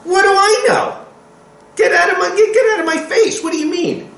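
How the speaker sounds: high mocking brush-off; sneering "get out of my face" to the Creator; defiant Cain. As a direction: toss off God's question scornfully. Preacher acts out Cain's defiance.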